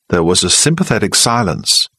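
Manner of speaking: ordinary delivery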